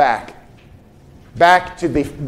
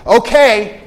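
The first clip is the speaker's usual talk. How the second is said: higher, louder exasperated rebuke; incredulous, louder scolding from the pulpit; reprimanding Paul; 5 semitones higher, 4 dB louder than usual. Retold or imitated. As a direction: scold them for never reading it. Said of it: "Paul saying something like this".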